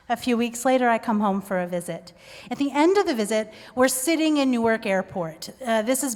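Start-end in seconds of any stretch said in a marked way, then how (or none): none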